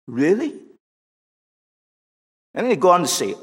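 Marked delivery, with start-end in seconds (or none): 0.1-0.5 questioning, with rising pitch; doubt creeping in. Acted. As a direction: cast doubt with an upward lift. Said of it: "Now the doubt comes in".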